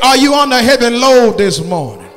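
normal talking voice

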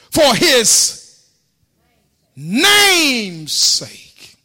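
high, loud, drawn-out whooping sermon; full-force preaching. Acted. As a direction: preach the psalm to a shouting climax.

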